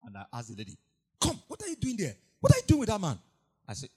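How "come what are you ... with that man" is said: confronting. Retold or imitated. Pastor confronting the girl.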